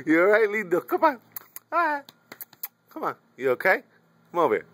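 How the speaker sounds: high coaxing call to a hurt pet. Real, not acted. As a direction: sweet-talk the dog to come over. Coaxing the hurt dog over.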